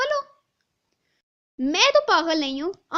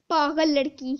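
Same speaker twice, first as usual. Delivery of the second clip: ordinary talk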